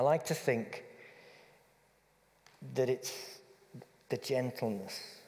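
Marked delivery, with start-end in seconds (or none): none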